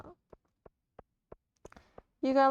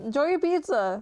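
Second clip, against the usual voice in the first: usual speaking voice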